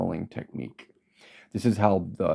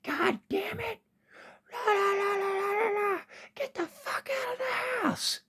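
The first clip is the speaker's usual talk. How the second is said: very high, strained demonic squeal; possessed mother; 6 dB quieter than usual. Retold or imitated. Son retells his mother turning on him, possessed shriek. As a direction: screech it like a possessed woman's other face. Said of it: "And her face went".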